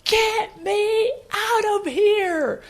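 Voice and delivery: high, drawn-out imitation scream; acted cry of frustration, stretched long and high; retold desperate scream. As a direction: scream it out slowly, word by word. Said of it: "screamed at the top of her lungs".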